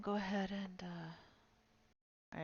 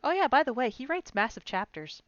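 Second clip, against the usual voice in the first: mock offhand friend; 6 semitones higher, 7 dB louder than usual.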